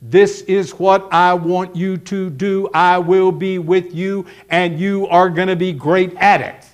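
slow, evenly spaced, deliberate delivery; plain words. Retold or imitated. God giving Gideon his orders, slow and plain. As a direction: spell it out word by word. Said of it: "in plain words".

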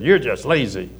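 imitated child's jab with a sting; biting tone. Nine-year-old showing how she scolded her sister, biting. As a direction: put some bite in the accusation. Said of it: "Let's say it without the bite".

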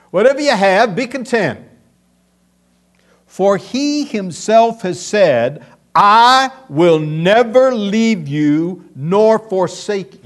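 loud, slow scripture proclamation; preaching at full force. Acted. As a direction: proclaim the promise to the congregation.

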